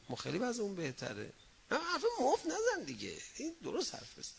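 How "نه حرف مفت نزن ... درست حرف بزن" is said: much higher, cutting rebuke; sharp scolding. Acted. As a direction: stop his idle talk with a high sharp voice. Preacher scolding imagined speaker.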